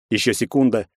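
plain reading voice

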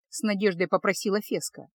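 ordinary delivery, nothing marked

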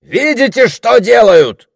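very high, loud, slow cry; indignant. A narrator's reading. Orator jerking toward the explosions, indignant. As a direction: point the outrage out to everyone. Оратор возмущён взрывами за рекой.

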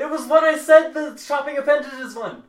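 high, loud protest; exasperated correction. Real, not acted. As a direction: shout it to set them straight. Correcting a listener who forgot.

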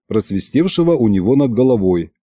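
usual speaking voice

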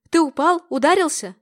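высоко и громко, в испуге; засыпать вопросами в панике; встревоженно. A narrator's reading. Мать в испуге над плачущим сыном. (мать тревожно расспрашивает сына)

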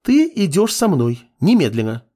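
high-pitched female command; bossy order. A narrator's reading. Girl dragging apprentice from his books, bossy. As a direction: order him along, no arguing.

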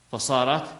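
usual speaking voice

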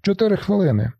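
high shouted call from the back. A narrator's reading. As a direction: yell the time out across the bus. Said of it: "Хтось кричить". (вигук здалеку)

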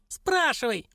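high squeaky cry; high-pitched, exasperated command; dwarf's yell. A narrator's reading. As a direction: yelp it out in a tiny creature's voice. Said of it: "заорал он".

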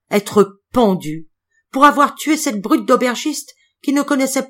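loud exalted cry, rising to a howl. Acted. Karl cries out to the hanged violinist, loud and exalted. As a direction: cry out in anguished awe. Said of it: "étendait les bras et criait".

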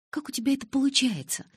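high-pitched annoyed question; irritated. A narrator's reading. Narrator asking Калин how he does it, irritated. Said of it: "раздражённо спросила я".

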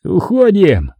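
high, urgent cry; high-pitched urgent order; shouted command. A narrator's reading. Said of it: "крикнул Сашка".

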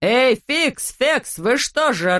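high, drawn-out summons; irate call. A narrator's reading. Klops in a trap calling servants, irate. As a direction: call the servants with indignant, stretched words. Trapped master yelling for his servants.

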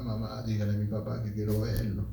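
very low, soft voice; hushed retold plea; quiet request, retold. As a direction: ask mother softly and humbly. Retelling his request to his mother.

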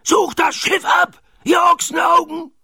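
very high-pitched roared order; Knurrhahn roaring. A narrator's reading. Pirate captain orders crew to search, roaring. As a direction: bellow it in a strained, high villain voice. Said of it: "brüllte Knurrhahn".

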